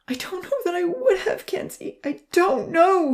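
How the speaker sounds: grief-stricken and pitched high; grieving. A narrator's reading. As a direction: cry it out in grief. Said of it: "he grieved".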